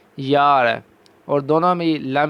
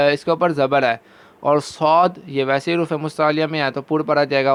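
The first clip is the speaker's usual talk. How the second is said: ordinary talk, nothing marked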